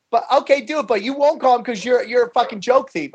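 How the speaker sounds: high-pitched imitated challenge; taunting heckler. Heckler accepting the comic's bet, mock high taunt. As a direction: push the dare as a mock heckler.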